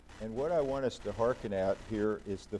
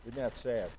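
normal talking voice

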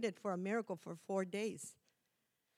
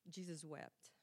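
ordinary talk

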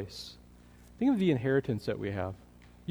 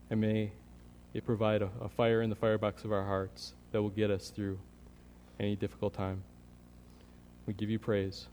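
soft, reverent prayer voice; closing prayer; 3 semitones lower, 5 dB quieter than usual. Acted. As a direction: pray quietly over the congregation.

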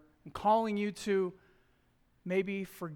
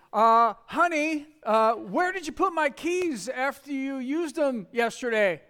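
loud, high, drawn-out sweet honey; fake-sweet with an edge; sickly sweet question to his wife; sugary accusing; 6 semitones higher, 9 dB louder than usual. Sweetly accusing wife about keys.

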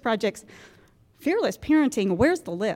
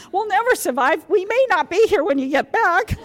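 higher, louder, laughing through the mock doom; laughing mock despair; 8 semitones higher, 6 dB louder than usual. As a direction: ham up the doom. Retold pleading with husband.

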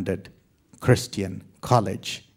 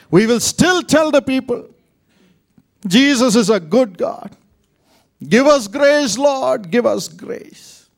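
high, loud cry of faith; 11 semitones higher, 9 dB louder than usual. Real, not acted. Grieving family crying out praise to God, loud and high.

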